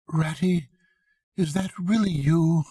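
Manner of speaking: small, high, weak voice from a hole; a tired little voice hoping it's true; feeble voice. Acted. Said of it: "a feeble voice saying".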